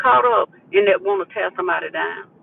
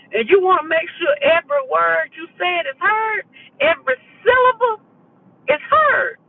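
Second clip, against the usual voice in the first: high upset energy; 10 semitones higher than usual. Shown as a demonstration. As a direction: push every syllable up high so it gets heard. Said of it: "it can get up there really high".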